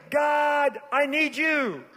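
high, forceful, urgent plea to God; impassioned preached prayer. Acted. Seeker pleading to know God personally, impassioned preached prayer. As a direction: cry out to God in rolling phrases.

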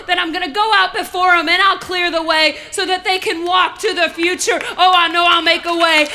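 proud, loud preacher as doting God; God's exuberant song. Acted. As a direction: belt out adoring improvised praise, building to a shout. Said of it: "he just makes up unrehearsed songs".

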